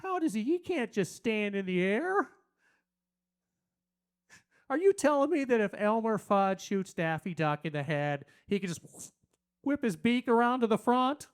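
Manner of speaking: imitating an incredulous, literal-minded sister. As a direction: object like a too-logical sibling. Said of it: "My sister's like".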